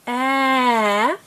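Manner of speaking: "Ah" held long and steady; slowly. Shown as a demonstration. Teacher showing a child the slow sound, drawn out. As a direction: stretch "Ah" out slowly. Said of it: "That's slowly".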